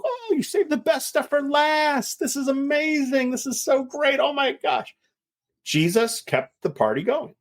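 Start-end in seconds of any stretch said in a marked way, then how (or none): 0.0-4.9 amazed guests. Retold or imitated. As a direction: gush like a delighted party guest. Guests praising the new wine.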